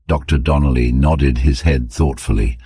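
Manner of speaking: ordinary delivery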